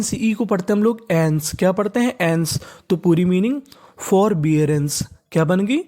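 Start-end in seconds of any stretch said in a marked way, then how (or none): none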